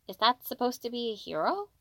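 puzzled question, lifting sharply at the end; confused wondering. A narrator's reading. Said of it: "Hiro wondered as he tilted his head in confusion".

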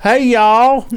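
high, loud mock Southern greeting; big Southern drawl on the greeting; Southern American accent. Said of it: "How'd you lose your accent?".

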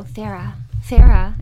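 insistent call to get her attention. Retold or imitated. Spirits calling for her attention.

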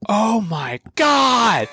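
high, slow awestruck; drawn out in awe; awed crowd gasp. Retold or imitated. Crowd amazed.

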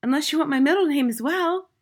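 high, eager schoolboy voice; high-pitched child, trying not to sound scared; brave little boy. A narrator's reading. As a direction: offer it pluckily as a small boy. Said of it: "He was a brave little fellow".

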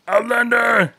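very high, loud crying bellow; Outlander shout. Retold or imitated. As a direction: wail the word out. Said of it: "I just gotta repeat that".

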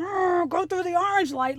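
a hint of a monster groan, urging; Frankenstein grunt. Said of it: "grunting like Frankenstein".

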